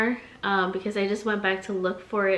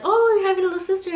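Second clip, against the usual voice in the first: high, fast, loud excited gush; mock delighted squeal; 10 semitones higher, 5 dB louder, much faster than usual. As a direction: gush like a delighted school lady. Ladies exclaim at school.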